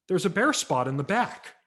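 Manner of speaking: a wary customer inspecting the tree; mother's doubt. Retold or imitated. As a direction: buyer pointing out a flaw.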